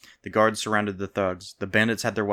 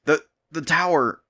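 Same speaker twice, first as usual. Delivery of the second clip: nervous stammer, higher pitch; 7 semitones higher, 5 dB louder than usual. A narrator's reading. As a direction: stumble over the words. Scared guard stammers.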